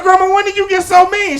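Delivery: high, loud protest, stress on so; mock-offended whine; indignant grandson. Grandson to his high grandma, mock-offended loud whine. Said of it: "I said".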